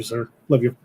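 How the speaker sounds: ordinary talk, nothing marked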